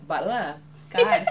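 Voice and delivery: slow, laughing stumble over the words; giggly exasperated blah; laughing at a flub. Real, not acted. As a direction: crack up at your own mistake. Flubs the show name, laughs.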